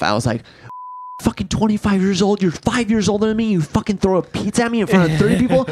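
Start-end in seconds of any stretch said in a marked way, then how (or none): 1.2-5.7 higher, mock-ranting, a retold chewing-out; going off. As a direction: tear into her, half performing the rage. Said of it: "I went off".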